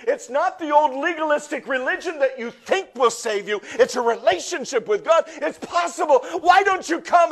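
high, loud preaching that swells into shouts; preaching at full force. Acted. Pastor preaching to the congregation, full force. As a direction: preach it hard to the crowd, building.

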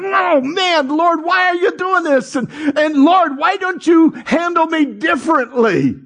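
high-pitched exaggerated whining; mock whiny complaint. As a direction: whine upward at God like a put-upon child. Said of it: "it's just grating against us. We go".

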